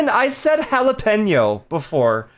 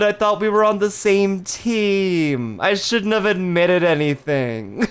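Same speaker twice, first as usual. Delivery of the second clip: slow, drawn-out playful lament; mock betrayed; slower than usual. Streamer to chatter who switched sides, mock betrayed. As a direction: stretch the last words in mock dismay.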